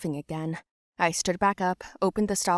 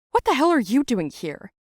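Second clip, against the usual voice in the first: loud, higher retold demand; angry confrontation; 5 semitones higher, 6 dB louder than usual. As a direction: bark it out at the other parent. Mom confronts Dredd's dad.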